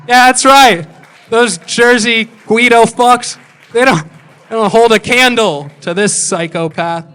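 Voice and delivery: loud, high, hammed-up bragging; drawn-out yelled victory boast; mock boastful shout. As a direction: crow it to the whole room in mock triumph.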